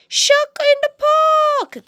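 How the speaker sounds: high, loud shout; fit to burst. Acted. Boy shouting the warning with all his might. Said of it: "till he's fit to burst".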